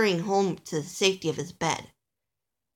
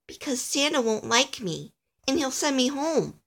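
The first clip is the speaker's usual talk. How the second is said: higher, fretful explanation; worried little elf; 6 semitones higher than usual. Acted. Alistair telling Nicholas why he fears Santa, fretful.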